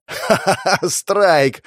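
high villainous laugh into a cheer; laugh, then crow the word; doctor's gleeful cackle. A narrator's reading. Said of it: "засмеялся доктор".

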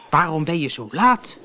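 a touch of grumbling impatience. Acted. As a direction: mildly grumble at the late arrival. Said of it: "mopperde die".

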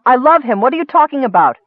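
loud, high, defensive outburst; angry. A narrator's reading. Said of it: "too angrily".

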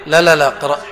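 normal talking voice

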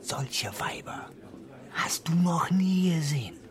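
slow, suggestive whisper; lurid whisper. Acted. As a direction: tempt him with a hushed secret. Lurid secret whispered to friend. (anzüglich geflüstert)

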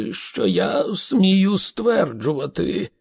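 high, slow, vague musing; absent-minded. A narrator's reading. Slartibartfast adds a doubtful afterthought, absent-minded. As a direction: stretch it out uncertainly. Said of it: "неуважливо відповів Сларті Бартфаст".

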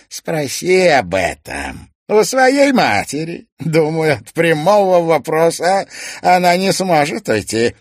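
high, loud gloating villain; theatrical villain. Acted. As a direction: relish every cruel word. Toymaster taunts.

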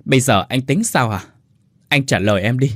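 ordinary delivery

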